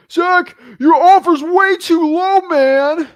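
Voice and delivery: high-pitched, drawn-out mock yell; protesting seller. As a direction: loudly whine the complaint, stretched out and hammed up. Acting out an upset seller's objection.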